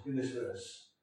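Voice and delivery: ordinary delivery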